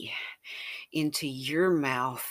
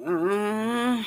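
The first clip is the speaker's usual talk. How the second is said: high, louder frustrated uh; disapproving moan; 7 semitones higher, 7 dB louder than usual. Acted. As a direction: moan in protest at being denied.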